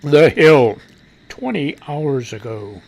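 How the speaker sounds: low, slow announcer-like read; stereo voice. Pretend. Host reading out the article's source, low stereo voice. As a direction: drop deep for the source, then slow down. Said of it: "let's get on our stereo voice".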